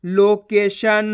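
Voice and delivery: लोकेशन in slow, stretched syllables; blended word reading. Shown as a demonstration. Teacher answering what the letters make together, slow blending. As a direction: blend लो-के-शन very slowly. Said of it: "तो बताइए मिलके क्या बनेगा?".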